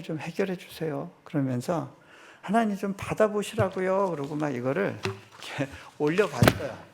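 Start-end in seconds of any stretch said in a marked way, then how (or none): 2.4-4.1 higher, pushier plea to God; exasperated plea. Retold or imitated. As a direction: shove the bills upward with a demanding cry. Pastor lifting bills up to God.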